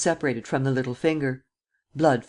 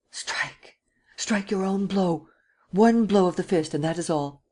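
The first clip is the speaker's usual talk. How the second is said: commanding; 5 semitones higher than usual. A narrator's reading. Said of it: "and commanded".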